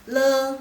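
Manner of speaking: le said very loud and clear; quiz syllable. Shown as a demonstration. Teacher reading a quiz syllable for students, loud. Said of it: "Laoshi baca salah satu".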